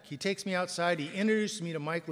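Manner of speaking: ordinary talk, nothing marked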